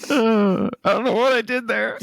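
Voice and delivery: high, helpless amusement; cracking up. Real, not acted. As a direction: squeal it out on the edge of laughter. Cracking up at own bad accent.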